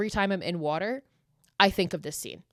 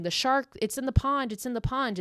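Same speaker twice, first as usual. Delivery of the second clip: retold scream; 6 semitones higher than usual. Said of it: "she starts screaming".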